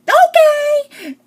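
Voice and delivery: tiny line in a very high, loud squeal; exaggerated high-pitched imitation; mock Sophia voice. Reviewer mocking Sophia's two lines, very high put-on voice.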